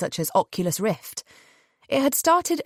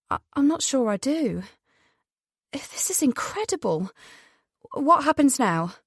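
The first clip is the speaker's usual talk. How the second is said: higher-pitched, hesitant wonder; stunned woman; 5 semitones higher than usual. Acted. Cora answers Jonathan after the call, stunned. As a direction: stammer in, voice lifted in amazement.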